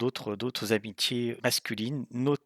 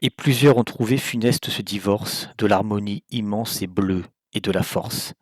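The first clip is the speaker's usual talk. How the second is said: measured, declamatory verse reading, set apart by pauses; poetry recitation; 10 dB louder than usual. Acted. Presenter reciting Verlaine's saturnine poems to listeners, solemn declamation. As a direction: declaim each line of verse with projected emphasis.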